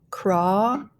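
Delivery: "crawl" stretched as craah, then a separate l; slow blended "crawl"; blending. Shown as a demonstration. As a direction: draw out the vowel of "crawl" with rising tune. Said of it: "start blending".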